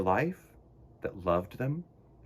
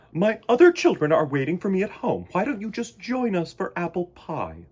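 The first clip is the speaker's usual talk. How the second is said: high, louder motherly bear voice; inviting mother bear; 8 semitones higher, 6 dB louder than usual. Acted. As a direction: invite the little bird home kindly. Missus Bear character voice.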